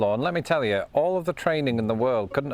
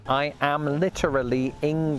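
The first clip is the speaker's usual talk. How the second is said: carefully separated syllables; enunciating each syllable. Retold or imitated. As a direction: spell it out for someone who can't understand. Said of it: "enunciating each syllable like I myself was talking".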